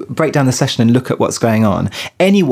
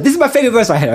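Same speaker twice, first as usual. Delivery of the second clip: a higher, lightly voiced singer impression; 11 semitones higher, much faster than usual. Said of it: "I can't do the accent, but it's like".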